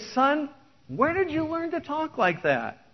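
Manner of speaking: high, startled question to his son; dad shocked at his son's swear word; shocked father. Acted. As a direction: catch the boy out in disbelief. Dad scolds son in song.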